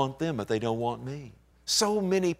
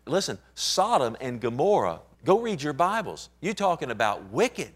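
ordinary talk

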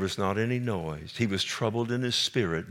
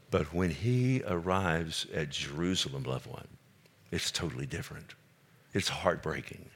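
hushed sorrow; 3 semitones lower, 6 dB quieter than usual. Real, not acted. Sorrowful aside, dropping to near-whisper.

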